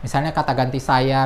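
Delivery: ordinary delivery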